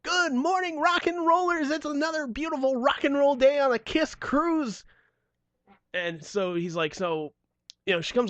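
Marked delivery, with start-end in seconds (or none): 0.0-4.8 much higher, bubbly morning-radio chirp; peppy announcer. Retold or imitated. Cruise announcer greeting passengers, peppy and high. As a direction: sell it like a perky cruise host. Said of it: "announcer every morning that would come on so peppy".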